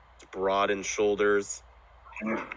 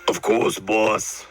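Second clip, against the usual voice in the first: louder, put-on henchman voice; obedient half-orc servant; 3 semitones higher, 5 dB louder, slower than usual. Half-orc answering his drunk boss, dutiful servant voice. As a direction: answer up firmly as the big henchman. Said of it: "So, Umari, Umari, you and your boss".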